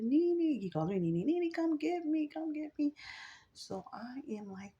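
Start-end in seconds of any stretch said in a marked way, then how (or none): 0.0-0.6 high-pitched mock crying plea; retold tearful call, pitched way up; imitating crying child. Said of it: "He's crying". 1.0-2.9 high-pitched pleading, mimicking a small boy; mock crying plea, pitched way up; imitating crying child. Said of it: "He's crying".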